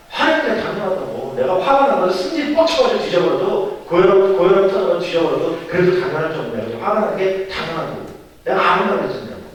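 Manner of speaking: acted indignant blow-up; defiant anger. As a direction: insist hotly that the anger is justified. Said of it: "승질을 팍 끌어내는 거예요".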